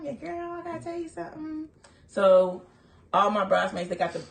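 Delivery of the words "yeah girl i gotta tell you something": high, soft, sly; hint at a secret; teasing hint. Retold or imitated. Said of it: "dropping hints".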